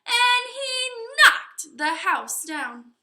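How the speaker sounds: high, loud, slow knockdown; storyteller's big moment; dramatic climax. A narrator's reading. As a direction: land the crash.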